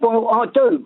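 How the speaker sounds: ordinary talk, nothing marked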